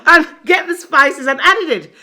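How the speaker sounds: high, loud laughing narration; real loud laughter while telling it. Real, not acted. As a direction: laugh your way through it. Laughing at husband rushing off.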